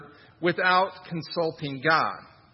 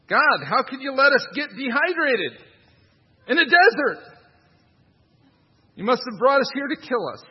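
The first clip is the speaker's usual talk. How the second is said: high, loud self-pitying wail; mocking thirsty soldiers crying out to God; mock whiny complaint; 7 semitones higher, 6 dB louder than usual. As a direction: ham up the Israelites blaming God. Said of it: "putting our own troubles on God".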